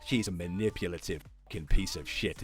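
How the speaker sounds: normal talking voice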